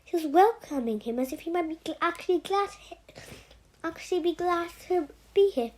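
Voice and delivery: plain reading voice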